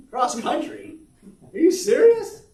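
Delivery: imitating his own shocked reply; incredulous shout. Retold reaction to son's news.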